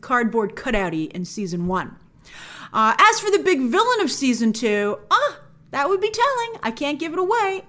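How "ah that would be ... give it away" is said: high-pitched playful secret-keeping; coy teasing. Pretend. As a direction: tease them, voice lifted high. Coyly withholding the villain's identity.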